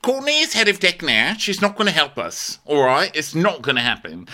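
mock firm crewmate; Talia. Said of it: "And Talia's like".

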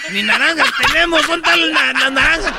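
mock outrage. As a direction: act scandalized there are no oranges.

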